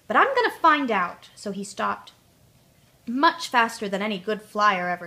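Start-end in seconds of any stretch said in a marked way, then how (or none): 0.1-1.3 higher and louder, defiant; decide it with a cross toss. A narrator's reading. Tootle defying his wheels, crossly determined. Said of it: "Tootle said crossly".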